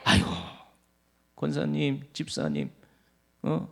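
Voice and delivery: a sugary blessing to a recovered elder; fond congratulation. Shown as a demonstration. Preacher shows greeting a recovered church elder. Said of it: "같이 즐거워하시기를 바랍니다".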